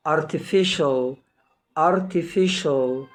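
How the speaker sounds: "artificial" drawn out slowly, C sounded as SH. Shown as a demonstration. Teacher modelling a word for learners, slow SH in "artificial". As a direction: say "artificial" very slowly with SH, then repeat. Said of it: "بتنطق شين".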